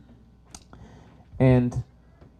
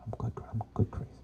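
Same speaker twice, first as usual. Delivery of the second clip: fast whispered aside; 3 semitones lower, 14 dB quieter, faster than usual. Real, not acted. Speaker whispering aside to audience, playful. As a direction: toss it off under the breath.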